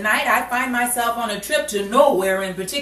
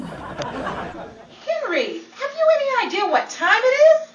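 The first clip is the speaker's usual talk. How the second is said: indignant outburst; 13 semitones higher, slower than usual. Acted. Betty scolds Henry for knocking in the night, high indignant. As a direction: scold him for waking you.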